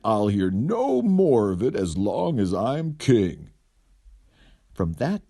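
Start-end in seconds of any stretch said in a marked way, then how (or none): 0.0-3.5 lightly voiced stern king; a hint of royal decree; king closing his ruling. King's decree in story.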